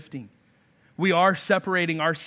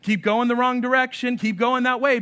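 higher, coaxing urging of the enemy; tempting enemy; 7 semitones higher, 4 dB louder than usual. Retold or imitated. The enemy urging the runaway to keep going, coaxing. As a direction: egg them on down the wrong road. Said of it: "the enemy is saying".

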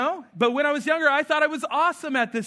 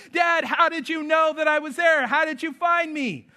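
put-on high, excited kid voice; 7 semitones higher than usual. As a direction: voice the delighted son, higher. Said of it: "all this laughing and giggling".

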